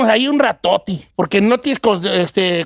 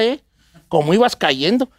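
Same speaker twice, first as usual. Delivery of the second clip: normal talking voice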